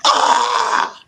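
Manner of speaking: normal talking voice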